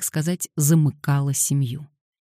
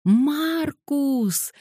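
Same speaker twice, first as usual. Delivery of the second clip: put-on high, long child's squeal of a name; high-pitched delighted call; joyful squeak; 12 semitones higher than usual. A narrator's reading. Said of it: "Ганория радостно пискнула".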